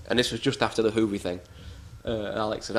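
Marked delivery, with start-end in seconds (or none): none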